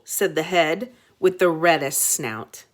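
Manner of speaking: plain reading voice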